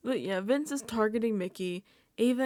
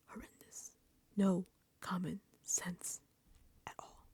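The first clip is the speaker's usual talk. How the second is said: hushed dismay; 2 semitones lower, 9 dB quieter than usual. Real, not acted. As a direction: whisper each word in dismay. Dramatic musing on a bleak season.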